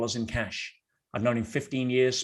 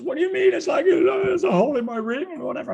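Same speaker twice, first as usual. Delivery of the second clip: very high, loud mock-upset woman's voice; hammed-up squeal of a fiancée in distress; imitated upset fiancée; 5 dB louder than usual. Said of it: "she gets all upset".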